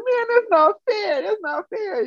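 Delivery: very high, drawn-out mock whine; jealous whining. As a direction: act out a sulking jealous kid. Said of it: "I was so jealous".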